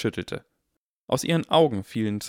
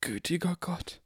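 put-on high, hushed old barkeeper; awed barkeeper; 9 semitones higher, 5 dB quieter than usual. A narrator's reading. Barkeeper staring at Harry, high and awed. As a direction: gasp it out in awe.

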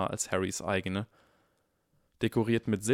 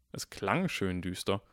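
ordinary delivery

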